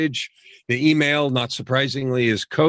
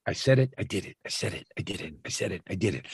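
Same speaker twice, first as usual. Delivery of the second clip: "I said it, I did it" in even pairs, dropping softer; repeated cadence; 4 semitones lower, 8 dB quieter, faster than usual. Shown as a demonstration. Coach showing what you need, in a steady falling cadence. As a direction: let each "I said it, I did it" fall lower and quieter. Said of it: "So you need".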